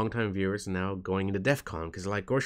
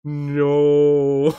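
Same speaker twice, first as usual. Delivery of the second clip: loud, high laughing protest; 5 semitones higher, 11 dB louder than usual. Real, not acted. Caught slipping into the accent, laughing loud and high. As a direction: laugh it off loudly.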